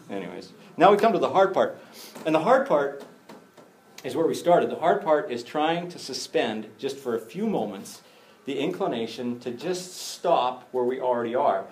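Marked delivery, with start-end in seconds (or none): none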